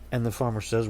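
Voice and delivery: ordinary delivery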